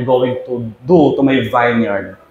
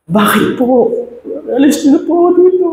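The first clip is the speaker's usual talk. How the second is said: very high, wailing plea; sobbing teacup. A narrator's reading. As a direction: cry out in despair. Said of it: "Sobrang umiyak siya". (napakataas na umiiyak na pakiusap)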